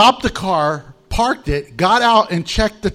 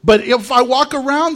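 ordinary delivery

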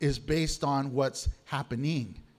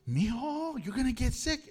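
mom's warning; 6 semitones higher than usual. Retold or imitated. Mother warning child at McDonald's.

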